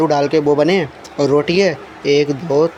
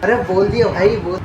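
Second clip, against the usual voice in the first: ordinary talk, nothing marked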